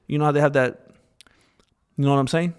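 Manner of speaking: ordinary talk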